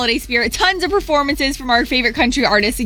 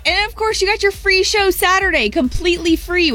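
excited promo; 7 semitones higher than usual. Acted. Hyping the free show.

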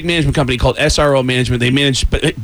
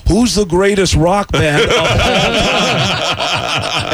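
very slow, higher rock star impression; 4 semitones higher, much slower than usual. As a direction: stretch out every word like a pompous star. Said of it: "I prank called them as [name]".

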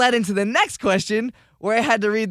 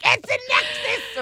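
very high mimicked correction; mock the crowd in a high put-on voice; imitated heckling crowd. Trivia host mimicking the audience correcting him, high mock voice. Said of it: "'Cause then everyone's like".

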